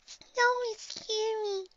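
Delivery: tiny, high, drawn-out voice; timid mumble. A narrator's reading. Shy pony hiding behind her mommy, timid mumble. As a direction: mumble shyly in a small, high voice. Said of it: "she mumbles".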